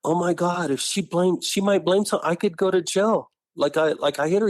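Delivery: high, mildly panicked retelling; imitating his worried brother. Brother fearing jail after the fight, a high, mildly panicked voice. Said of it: "So he's going".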